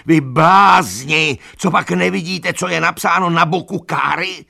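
high, loud, exasperated scolding; agitated shouting. A narrator's reading. Donkey berates animals over the sign, agitated shouting. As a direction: berate them at the top of your voice. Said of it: "křičel Benjamin".

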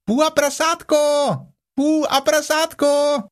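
very high, squeaky calling; piercingly high little creature; excited squeal. Acted. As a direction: squeal it out to friends below. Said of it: "něco velmi rozčileně zapištělo".

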